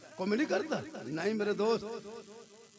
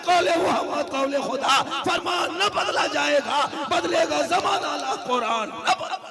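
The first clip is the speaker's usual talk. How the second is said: loud, high declaimed verse; thundering sermon declamation; full-force preaching; 7 semitones higher, 4 dB louder than usual. Acted.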